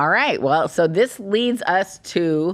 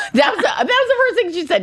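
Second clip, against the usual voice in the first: laughing through the words, much higher; 13 semitones higher, 3 dB louder, faster than usual. Real, not acted. Host recounting her sister's first response, laughing. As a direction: say it while cracking up. Amused by sister's blunt reply.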